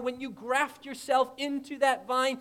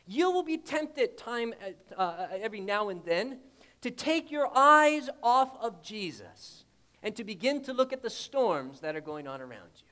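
ordinary talk, nothing marked

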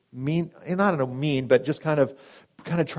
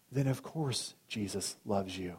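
gentle reassurance; 3 semitones lower, 11 dB quieter, slower than usual. Retold or imitated. Pastor comforts dying man.

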